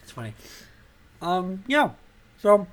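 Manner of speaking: talking through ice cream; words round a mouthful; mouth full. Real, not acted. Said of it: "sorry, I'm eating ice cream".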